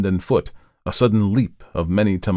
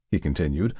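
plain reading voice

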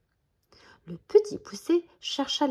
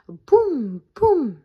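loud, higher booming sound words; booming footsteps; 4 semitones higher, 10 dB louder than usual. A narrator's reading. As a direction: shout it like giant steps shaking the ground. Ogre's giant footsteps.

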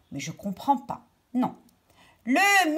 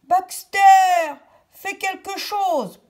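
higher, louder call for help; crying out; 7 semitones higher, 7 dB louder than usual. Acted. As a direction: call to Baxter in alarm. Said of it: "Perrine cria".

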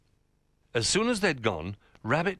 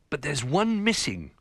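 higher-pitched worried cry; alarmed cry; 7 semitones higher, 4 dB louder than usual. A narrator's reading. Ruff noticing a biscuit is gone, alarmed cry. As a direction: call out the sudden discovery. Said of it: "cried Ruff".

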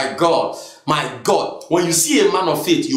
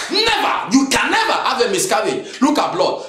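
high, emphatic cry of refusal; loud faith; 5 semitones higher than usual. Acted. Answering news of a miscarriage with defiant faith, high and emphatic. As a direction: reject it outright with pitch leaping up. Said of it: "That's loud faith".

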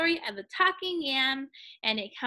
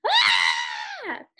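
storybook cry of alarm; fisherman's shout; 4 dB louder than usual. A narrator's reading. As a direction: shout out in sudden fright. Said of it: "the fisherman shouted".

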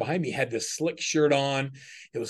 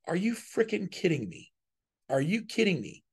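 higher, half-laughing incredulous retort; mock disbelief; 6 semitones higher, 3 dB quieter than usual. Retelling his reaction to the rival's hundred sales, lightly incredulous. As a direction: replay the stunned reaction lightly.

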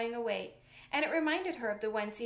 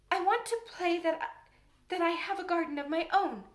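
hesitant, halting higher voice; stammering; 6 semitones higher, 5 dB louder than usual. A narrator's reading. Shy Mary stammers to the gardener about a garden. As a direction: stumble shyly over the words. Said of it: "she stammered".

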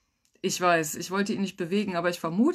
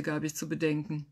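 ordinary delivery, nothing marked

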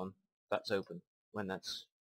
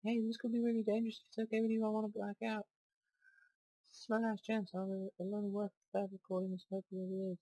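high character voice; 12 semitones higher than usual. Acted. Voicing game character dialogue.

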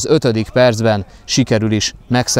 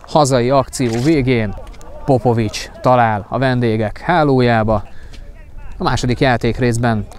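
normal talking voice

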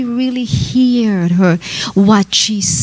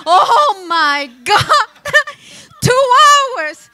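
high, laughing gasp at the length; laughing disbelief; 14 semitones higher, 4 dB louder, much slower than usual. Retold or imitated. Preacher laughing at her own two-hour sermon. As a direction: giggle it out in shock. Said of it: "I'm going".

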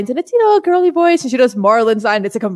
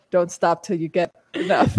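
Marlon Brando; 5 semitones lower, 6 dB quieter than usual. Retold or imitated. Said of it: "And then Marlon Brando goes".

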